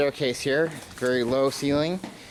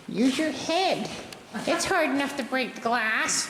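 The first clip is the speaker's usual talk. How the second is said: quoting Devin; slower than usual. Retold or imitated. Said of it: "Devin just said to me".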